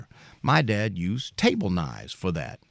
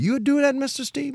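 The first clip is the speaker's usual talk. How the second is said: very high pleading question; neighbor voiced. Neighbor asks Mister Steve if he'll really wait, very high.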